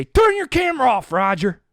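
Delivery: high, loud command; acted irate shout; angry order. Ordering cameraman to stop filming.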